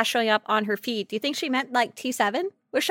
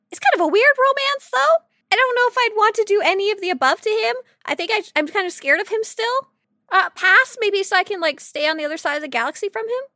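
high, nervous, half-laughing; a touch of mock fear, pitched up; nervously joking; 9 semitones higher than usual. Real, not acted. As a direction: sound playfully scared of him. Nervous about a scary character.

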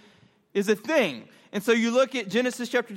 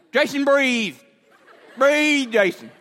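raised voice, imitating a father's command; urging dad; 4 semitones higher, 5 dB louder, slower than usual. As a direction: call out to get a child breathing. Dad urges choking son to breathe.

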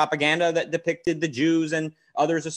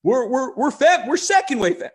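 mocking, pitched way up high; sarcastic mock voice, much higher; 10 semitones higher, 4 dB louder than usual. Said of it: "I'm being sarcastic".

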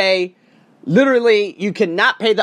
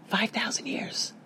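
whispered for effect, slowly; dramatic whisper; 6 semitones higher, 12 dB quieter than usual. Real, not acted. Vlogger whispering the figure to his viewers for drama. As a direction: breathe the figure out in a hushed whisper.

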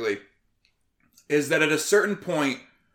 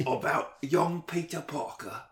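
Michael Caine. Acted. Host telling listeners about young Peter Parker, Michael Caine impression. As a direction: recite solemnly in a put-on British voice, with pauses. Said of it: "that's a pretty good Michael Caine".

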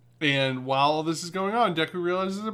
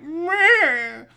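much higher, drawn-out bratty meh; mocking meh; 14 semitones higher, 6 dB louder than usual. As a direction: sneer like a sulky kid. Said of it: "And he's like".

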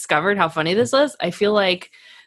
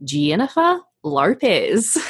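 Australian accent; 2 semitones higher, much slower than usual. Shown as a demonstration. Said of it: "because it sounds like this".

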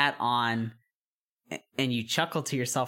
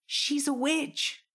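slow, high, drawn out; ominous reveal; 11 semitones higher, slower than usual. Acted. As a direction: lean into it with menace.

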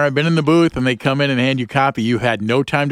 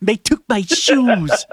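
put-on [name] prank caller; 9 semitones higher, slower than usual. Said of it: "Saul Rosenberg".

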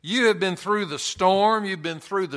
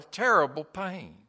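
ordinary talk, nothing marked

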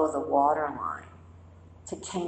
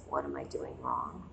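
much lower and softer, a worried murmur; anxious inner voice; 11 dB quieter than usual. Retold or imitated. The meditator's inner voice during calm practice, low murmur. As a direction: drop it low like a nagging doubt. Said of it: "then the voice goes".

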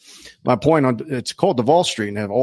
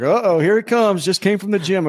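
higher-pitched, lightly hyped host; announcer quote; 8 semitones higher than usual. Retold or imitated. Host teasing the crowd about the muscled challenger, raised voice. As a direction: lightly play up the hype. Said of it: "the guy's like".

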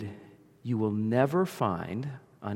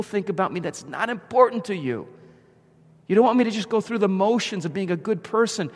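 raised, earnest pleading to God; fervent prayer voice; 6 semitones higher, 4 dB louder than usual. Acted. Preacher voicing David's prayer, fervent and raised. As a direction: preach the psalm as a heartfelt prayer.

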